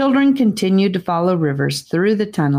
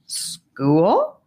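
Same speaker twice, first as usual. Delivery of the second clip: "School?" with a surprised questioning rise at the end; puzzled question; 2 semitones higher than usual. Acted. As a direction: echo "School?" back, puzzled and rising. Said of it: "asked Sephora with raised eyebrows".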